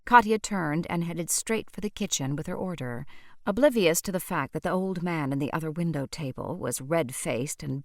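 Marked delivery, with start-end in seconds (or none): none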